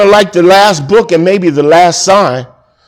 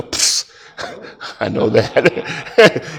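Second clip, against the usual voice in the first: lightly voiced God, softer, with a chuckle; playfully imitated God; 5 semitones lower, 7 dB quieter, much slower than usual. God answering praise in heaven, mock offhand. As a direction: brush off praise in a soft, laughing mock reply. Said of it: "And he's gonna go".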